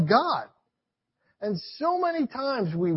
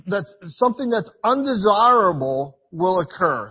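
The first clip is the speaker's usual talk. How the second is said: ordinary delivery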